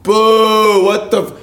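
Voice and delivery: high-pitched heckler's boo; mock heckling. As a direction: boo the stage in mock outrage. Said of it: "No one goes".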